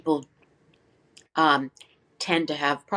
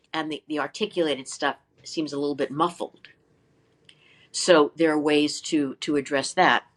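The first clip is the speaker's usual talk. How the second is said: usual speaking voice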